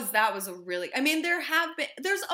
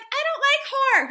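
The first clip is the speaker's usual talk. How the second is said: high, raised-volume mock-innocent voice; sweet innocent one; 6 dB louder than usual. Host mimicking the sweet, innocent ones, high mock-innocent voice. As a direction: play the sweet innocent, pitched way up. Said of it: "the sweet, innocent ones who's like".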